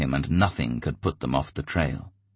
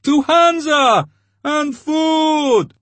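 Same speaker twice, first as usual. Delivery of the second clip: much higher, loud, slow chant; starving crowd shouting their demand; 8 dB louder, much slower than usual. A narrator's reading.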